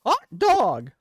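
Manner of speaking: very high, loud squeaky cartoon mouse; Mickey Mouse voice. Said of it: "you don't enjoy Mickey Mouse's voice?".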